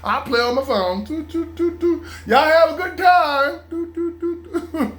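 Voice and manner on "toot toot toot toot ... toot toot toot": high playful toot toot; teasing singsong. Pretend. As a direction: sing it out mockingly. Mocking goodbye singsong.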